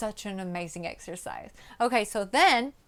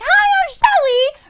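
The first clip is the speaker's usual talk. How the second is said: much higher, louder, strained squeal; how not to walk in; mock bad intro; 14 dB louder than usual. Shown as a demonstration. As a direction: parody of an overdone greeting. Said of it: "you don't wanna be like".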